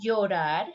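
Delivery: Llorar as "yorar"; soft y pronunciation. Shown as a demonstration. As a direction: start Llorar on a gliding y. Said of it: "Listen the pronunciation".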